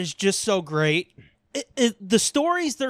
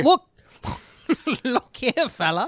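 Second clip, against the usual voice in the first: put-on old-timey tough guy; 3 semitones higher than usual. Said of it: "I'm fucking nineteen forties Doom".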